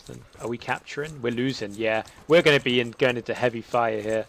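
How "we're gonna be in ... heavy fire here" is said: urgent shout. Real, not acted. Under fire, calling to squad.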